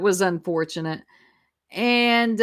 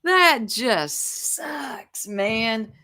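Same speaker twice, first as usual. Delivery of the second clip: drawn-out mock sympathy; 3 semitones higher, much slower than usual. Real, not acted. Host rubbing in a failed joke, drawn-out mock sympathy. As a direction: drag out each word, lingering on the last. Relishing the other's blunder.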